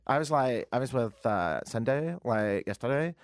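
put-on Toronto accent full of "like"; low, drawling parody of trendy Toronto talk; King West accent. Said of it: "that's all I ever hear".

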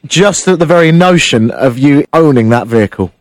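loud, hammed-up flirty tease; mock seductive. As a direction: lay on the fake seduction. Host mock-seducing caller on air.

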